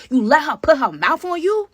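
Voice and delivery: high, loud, slow jealous accusation; jealous yell. Retold or imitated. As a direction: yell it at him, betrayed. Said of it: "She's like".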